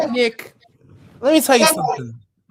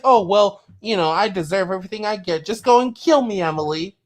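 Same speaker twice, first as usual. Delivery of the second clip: mock self-pity; 7 semitones lower, 3 dB quieter than usual. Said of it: "you're acting like".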